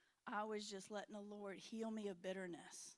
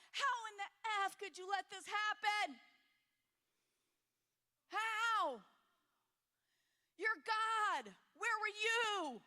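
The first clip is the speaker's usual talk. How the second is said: angry at God; 10 semitones higher, 7 dB louder, slower than usual. Retold or imitated. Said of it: "That's how angry I got at God".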